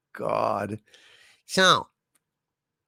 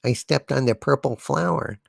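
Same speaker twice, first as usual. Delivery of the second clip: lower, flat-toned listless confession; 7 semitones lower, 5 dB quieter than usual. A narrator's reading. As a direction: sigh it out, defeated.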